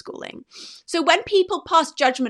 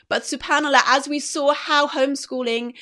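ordinary talk

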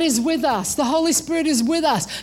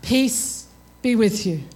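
slow, lower, measured blessing; unruffled counselor; 6 semitones lower, slower than usual. Acted. Counselor answers someone in their most difficult time, unruffled. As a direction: give the line slowly and low. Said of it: "they say to you".

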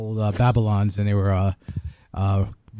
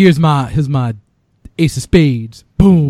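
bad Cajun accent; 6 dB louder, slower than usual. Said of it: "that was a bad Cajun accent".

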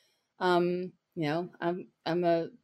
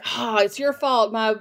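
mock client yell; 5 semitones higher than usual. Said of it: "well, like, yell".

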